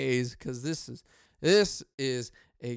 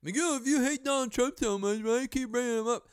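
high, fast heckling voice at himself; mock heckler; 8 semitones higher than usual. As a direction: jeer at yourself in a put-on voice.

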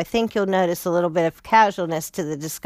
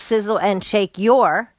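normal talking voice

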